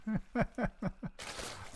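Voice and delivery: soft, high chuckle; little private giggle of amusement; amused chuckle. Real, not acted. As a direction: chuckle quietly to yourself. Chuckles at a small play.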